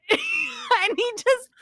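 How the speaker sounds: high squealing laugh; laughing hard. Real, not acted. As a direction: burst out laughing mid-sentence. Laughing retelling the scare.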